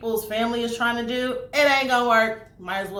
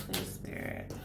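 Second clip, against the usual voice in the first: soft unvoiced whisper; 14 dB quieter than usual. Real, not acted. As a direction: breathe the plea in a whisper. Whispered plea to Spirit.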